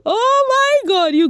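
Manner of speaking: high, loud laugh-cry. Real, not acted. Vlogger recalling the emotional reunion, laughing hard and high. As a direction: break into a shrieking laugh. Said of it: "I was crying and laughing".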